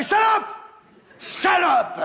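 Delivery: high, loud insult; furious shout. Acted. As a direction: scream at the cheating wife. Husband finds bag.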